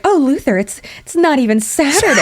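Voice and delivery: playfully seductive. Retold or imitated. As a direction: imitate a wife trying to sound sexy. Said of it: "trying to get all sexy".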